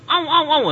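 high, loud squealing "au, au, au"; hammed-up startled cries of a woman; mock yelps. Imagined women yelping during prayer. (jeritan kaget pura-pura, tinggi dan keras)

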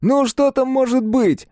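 put-on high, slow teen boy voice; a scornful teenager; sulky teen mumble. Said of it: "своим подростковым голосом".

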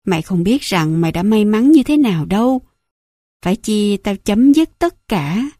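plain reading voice

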